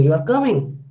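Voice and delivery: "You are coming" with pitch swung up on coming; rising intonation. Shown as a demonstration. Teacher demonstrating rising intonation, "You are coming" asked with a lift on coming. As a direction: make "You are coming" a question by lifting "coming". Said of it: "It's a rising".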